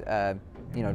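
usual speaking voice

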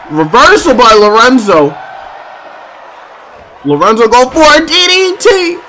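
hyped play-by-play call; wrestling commentator. Acted. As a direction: call the match with hype. Excited play-by-play calling.

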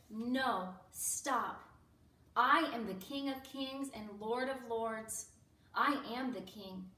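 loud, firm declaration; commanding refusal. Acted. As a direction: assert royal authority forcefully. Imagined commanding refusal by Jesus.

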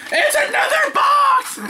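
very high, drawn-out mock-outraged shout; exasperated shriek. Opening a box to find another, exasperated shriek. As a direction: shriek it slowly in hammed-up disbelief.

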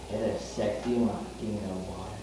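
low, soft come-on; sultry put-on murmur; mock seductive. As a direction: flirt with it, dropped low and quiet. Tries seducing the machine.

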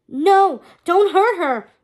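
loud, high desperate plea; urgent protest. A narrator's reading. Hector begs Hades to spare May, urgent. Hero pleads for captive friend.

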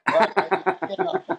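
slow, laughing admission. Real, not acted. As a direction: give up, helpless with laughter. Can't get mad at son.

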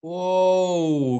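lightly acted wobbly-branch groan; mild groan. A narrator's reading. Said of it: "groaned Tortoise and Bird".

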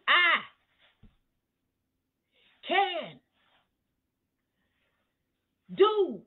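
"I", "can", "do" each punched high and loud, long pauses between; emphasis on each word. Shown as a demonstration. Preacher showing the congregation the power in a verse, every word of "I can do" emphasized. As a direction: hammer "I", then "can", then "do", each alone. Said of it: "Look where you put the emphasis".

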